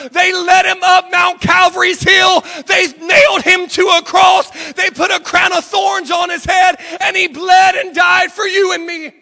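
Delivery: high, loud pulpit shout; screamed preaching. Retold or imitated. Pastor preaching the cross to prisoners, screamed at full force. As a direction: proclaim the crucifixion at full voice. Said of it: "began to scream from the top of my lungs".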